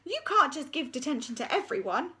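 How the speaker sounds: high, breathy appeal; secretary begging Miss Seeth; pleading secretary. Acted. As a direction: plead with the raging deputy. Secretary protests.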